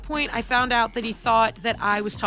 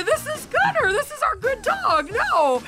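reassuring dad, high and soothing; 13 semitones higher than usual. Retold or imitated. Dad reassuring crying son.

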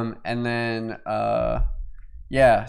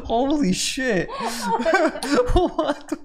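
high, slow, laughing in disbelief; 10 semitones higher, much slower than usual. Real, not acted. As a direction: laugh through the words in shock.

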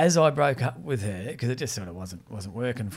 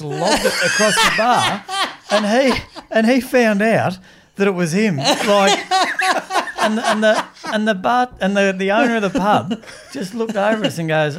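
loud, high laughing telling; real laughter at the punchline; laughing storytelling; 8 semitones higher, 8 dB louder than usual. Real, not acted. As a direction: crack up while telling it. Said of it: "laughing while telling".

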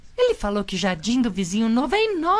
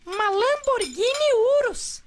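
lightly voiced son, higher and slow; drawn out with a hint of a kid; son Pimpolho Júnior; 9 semitones higher, slower than usual. Son bragging about neighbour's car, lightly higher voice. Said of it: "Pimpolho Júnior".

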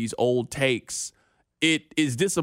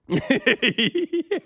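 laughing; 12 semitones higher than usual. Real, not acted. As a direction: laugh out at the joke. Laughing at all-you-can-drink pass.

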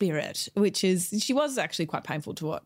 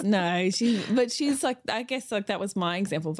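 ordinary talk, nothing marked

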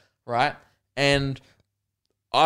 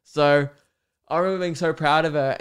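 usual speaking voice

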